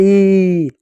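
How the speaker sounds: long held "ई", imitating children calling it out; children's drawn-out ई. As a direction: stretch "ई" out on a steady note. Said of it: "बच्चे कुछ और चिल्लाते हैं".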